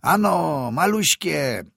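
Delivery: high, loud yell to urge on horses; peasant's yell. A narrator's reading. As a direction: holler at the horses, drawn out. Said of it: "wrzasnął chłop na konie".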